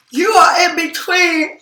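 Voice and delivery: words stretched by a yawn. Real, not acted. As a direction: say it mid-yawn. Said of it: "I didn't mean to yawn like that".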